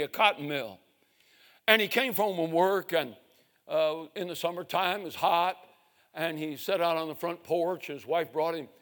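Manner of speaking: usual speaking voice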